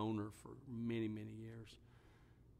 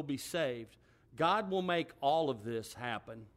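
higher, louder pulpit preaching building to a shout; full-force preaching; 4 semitones higher, 7 dB louder than usual. Acted. Pastor preaching to his congregation about facing death, rising to a shout. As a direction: drive the scripture home to the church.

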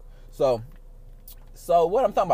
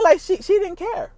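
incredulous; 13 semitones higher than usual. Real, not acted. Grandma watching the sex scene.

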